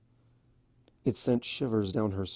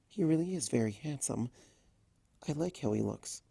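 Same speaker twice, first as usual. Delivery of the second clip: softer, hushed private musing; soft inner thought; 3 semitones higher, 8 dB quieter than usual. A narrator's reading. Fluttershy thinking about Discord, hushed and shy. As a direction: say it to yourself, shyly.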